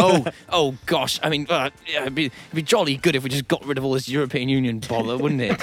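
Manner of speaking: bumbling upper-class English politician; posh English politician. Retold or imitated. Imitating posh British prime minister.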